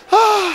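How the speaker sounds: high yelp of cold; shocked cry. Retold or imitated. Icy shower again, a high yelp.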